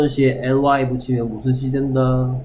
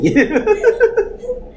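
high burst of laughter. Real, not acted. Teacher cracking up at a funny answer, high laughing. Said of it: "착히".

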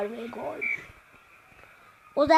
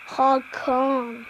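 usual speaking voice

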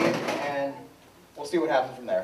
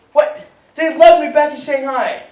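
indignant outburst; 8 semitones higher, 8 dB louder, slower than usual. Acted. Donald outraged at his team.

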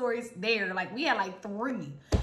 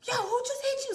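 alarmed friend; 10 semitones higher, faster than usual. Retold or imitated. Friend reacts to the hit.

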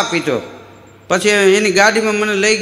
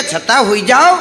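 mild doctor's order; 3 semitones higher than usual. Retold or imitated. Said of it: "મને કે".

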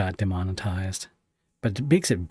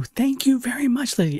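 squeaky high voice thanks; high-pitched; 3 dB louder than usual. Real, not acted.

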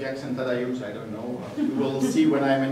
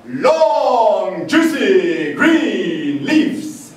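greasy Texan poem; 12 semitones higher, 13 dB louder than usual. Acted. Poet reciting his horse-ride poem to the audience, loud Texan accent. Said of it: "to be read in a greasy Texan accent".

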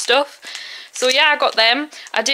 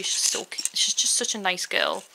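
whisper; 4 semitones lower, 8 dB quieter than usual. Real, not acted. Gushing softly about a friend.

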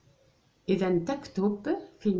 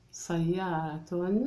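slow, stretched reading of سيارة; drawn-out word; much slower than usual. Shown as a demonstration. Teacher reading to pupils, slow and drawn out. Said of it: "teacher sounds out the word".

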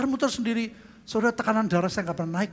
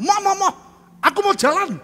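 loud, high pleading; insistent protest; 13 semitones higher, 9 dB louder than usual. Retold or imitated. Patient protesting to the hospital staff about the stretcher, loud and high. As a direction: beg loudly like a stubborn patient.

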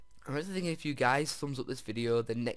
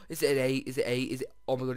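usual speaking voice

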